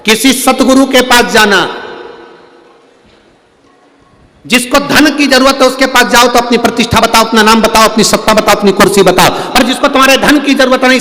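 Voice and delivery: high, loud, driving sermon voice; fervent preaching. Acted. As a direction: hammer the lesson home to the crowd. Preaches at full force.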